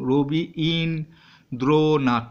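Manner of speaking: রবি, ইন্দ্রনাথ said slowly, each syllable apart; syllables split apart. Shown as a demonstration. Teacher showing pupils the syllable breaks, slow and separated. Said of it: "আমরা যখন উচ্চারণ করি তিনটে ভেঙে নিই".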